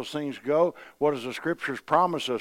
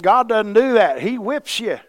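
loud, driving revival preaching; fiery preacher, higher and louder; preaching at full force; 10 semitones higher, 11 dB louder than usual. Acted. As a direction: pound it home from the pulpit, slowing on the warning. Preacher quoting Paul.

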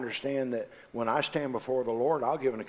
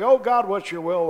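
pleading prayer; 8 semitones higher, 8 dB louder than usual. Retold or imitated.

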